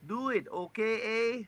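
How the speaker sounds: badly faked Canadian accent, high and drawn out; hammed-up Canadian accent with a tagged-on "eh". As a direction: put on a slow, high-pitched mock Canadian pleading. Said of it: "It's my Canadian accent".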